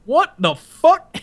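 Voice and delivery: high, slow mock disbelief; shocked outburst. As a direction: imitate an appalled instructor, stretching each word. Instructor's shocked outburst retold.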